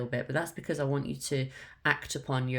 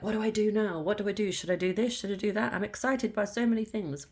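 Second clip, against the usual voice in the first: overwhelmed inner voice; 6 semitones higher than usual. Retold or imitated. Voicing an overwhelmed person's thoughts.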